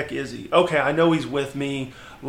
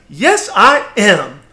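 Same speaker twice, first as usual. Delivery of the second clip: slow, higher, lightly voiced older lady; a hint of a cheerful lady; 5 semitones higher, 6 dB louder, much slower than usual. Telling how the cashier answered, lightly voiced and slow. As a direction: say it slowly and sure, like a content older woman.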